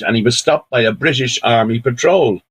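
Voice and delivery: ordinary delivery, nothing marked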